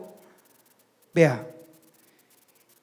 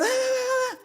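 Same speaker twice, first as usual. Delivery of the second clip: much higher, shrill mock scream of a wife; mock shriek; 5 dB quieter than usual. Wife shrieking back at Micaía in a pretend fight, mock shriek. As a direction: shriek back at the husband, hammed-up. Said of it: "y la mujer también".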